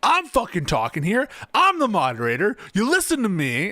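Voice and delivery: hammed-up impression of a moderator on a power trip; power-tripping moderator. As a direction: bark orders like a self-important moderator. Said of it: "there's a bit of a power trip".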